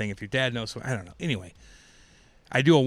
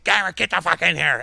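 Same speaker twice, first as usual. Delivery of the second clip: coach yelling; 5 semitones higher, 6 dB louder than usual. Retold or imitated. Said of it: "seeing him be like".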